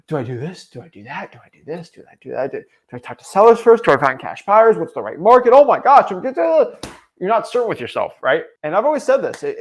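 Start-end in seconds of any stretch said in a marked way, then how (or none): 0.1-2.5 soft, anxious muttering to yourself; nervous whispered indecision; anxious dithering. Acted. Acted confused beginner's inner voice. 2.5-6.8 higher and louder, panic building; frantic overwhelmed questions; rising panic. Acted. Acted beginner spiralling into panic.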